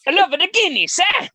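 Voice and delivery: mock Irish pub voice. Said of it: "Stereotypical voices".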